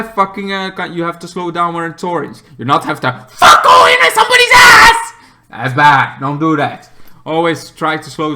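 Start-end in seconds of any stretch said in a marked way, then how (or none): 3.3-5.1 high, loud angry yell; angry shout. Real, not acted. Ranting to viewers at drivers who rush orange lights, loud and angry.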